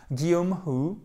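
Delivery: ordinary delivery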